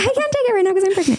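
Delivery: quick, high, lightly voiced stepsister; light sister impression. Lightly imitating the stepsister's quick, high pregnancy reveal. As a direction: toss it off fast and high like the sister.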